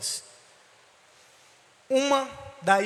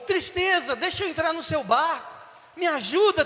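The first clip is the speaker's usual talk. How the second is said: higher, pleading call out across the water; desperate call; 6 semitones higher than usual. A narrator's reading. Love in the water begging sadness for a place, pleading call. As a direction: beg for help while drowning.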